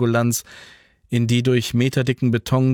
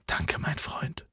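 slow, soft whisper; whispered thanks; 4 semitones higher, 12 dB quieter, slower than usual. A narrator's reading. Jan thanking Franz in a whisper. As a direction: breathe the thanks.

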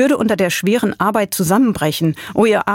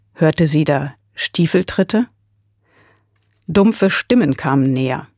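usual speaking voice

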